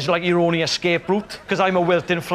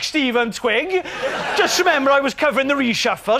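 smug answer; 7 semitones higher than usual. Retold or imitated. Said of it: "She's like".

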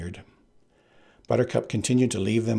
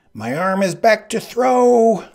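much higher, louder, slow; Sicilian's voice; 12 semitones higher, 9 dB louder, slower than usual. Acted. Sicilian about to throw the blood, high and slow. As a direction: drag it out as a threat.

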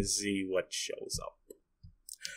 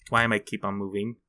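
usual speaking voice

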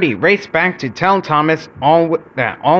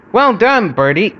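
high, mildly raised shout of praise; shouted cheer; 6 semitones higher, 3 dB louder than usual. A narrator's reading. Passengers urge Bertie on downhill, shouting praise. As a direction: call out encouragement. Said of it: "shouted his passengers".

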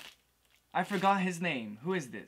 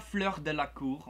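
Fleur de la Cour with French throaty r's; "Fleur de la Cour en français" pronounced the French way; French pronunciation. Shown as a demonstration. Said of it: "en français".

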